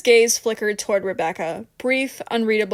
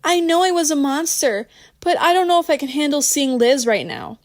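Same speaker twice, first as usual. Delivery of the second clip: higher-pitched regretful confession; thick with regret; 5 semitones higher, 4 dB louder than usual. A narrator's reading. Rebecca admits she was a monster, regretful. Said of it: "her voice thick with regret".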